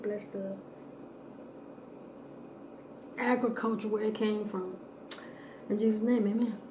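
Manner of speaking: soft, quiet grace before a meal; praying. Acted. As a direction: say grace softly and reverently. Says grace before eating.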